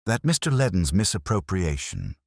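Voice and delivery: usual speaking voice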